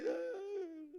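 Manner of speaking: high, drawn-out fake crying whine; hammed-up high whimper; mock wail. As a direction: wail like a sulker who took offence. Mocks Peter crying, taking offence.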